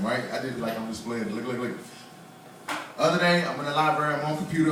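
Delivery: ordinary delivery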